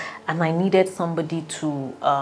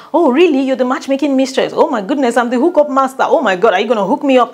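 excited banter; 6 semitones higher, 6 dB louder, faster than usual. Retold or imitated. Presenter bantering excitedly on radio.

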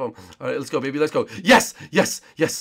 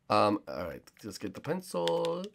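normal talking voice

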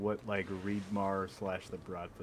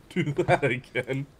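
teasing; 5 semitones higher, 10 dB louder than usual. Real, not acted. Teasing after choking.

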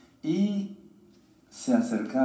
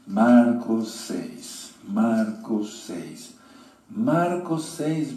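anuncio lento y enfático; 4 dB louder, much slower than usual. Shown as a demonstration. Anuncia la cita.

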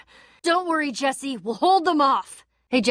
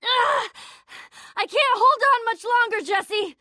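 high, straining cry; strained desperation; 8 semitones higher than usual. Acted. Friend straining against Palma calls Jesse, desperate. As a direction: hold on with your last strength.

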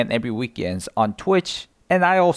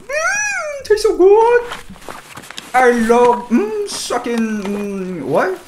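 mock savouring; 11 semitones higher, 4 dB louder, much slower than usual. Real, not acted. Savouring the kill loot.

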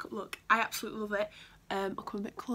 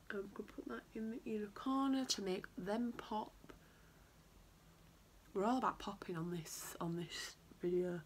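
whispering; 6 dB quieter than usual. Real, not acted. Whispered aside while applying.

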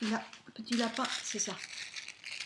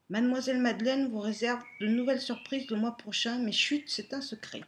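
reading aloud; 4 semitones higher, 6 dB louder than usual. Acted. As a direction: read it like a fairy tale. Reads the card aloud.

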